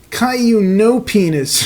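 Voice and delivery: high, slow taunting singsong; high teasing baby voice. Acted. Baby sister Rosie mocking Caillou, high teasing voice. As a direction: tease him up high. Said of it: "she laughed".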